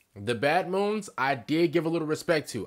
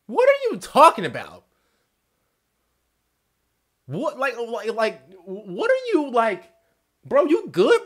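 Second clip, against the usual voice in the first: higher, louder, disbelieving; incredulous outburst; 8 semitones higher, 4 dB louder than usual. Real, not acted. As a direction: react in real shock at nonsense. Baffled reaction to orc speech.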